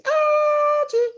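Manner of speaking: very high squealing call; shrieked name. Acted.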